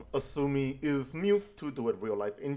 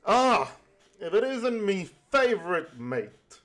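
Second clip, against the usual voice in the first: high, loud pirate hail; pirate captain greeting; 9 semitones higher, 4 dB louder than usual. Acted. Kenway hailing his rival ship's captain, mock-jolly pirate greeting.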